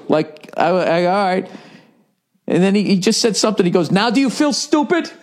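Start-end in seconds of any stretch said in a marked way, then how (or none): 3.9-5.1 high, smug put-on taunt; smug driver. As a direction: gloat like a snotty rich guy, pitched up.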